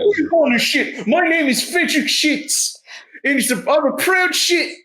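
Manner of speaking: high, hammed-up offended voice; indignant guard. Guard answering an insult from a traveler, high and indignant. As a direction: protest proudly in a high comic guard voice.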